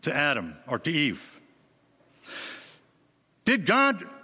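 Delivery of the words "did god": tempting Satan. Retold or imitated. Preacher voicing Satan questioning Eve, a hint of sly tempter. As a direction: plant the doubt slyly. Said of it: "Satan said".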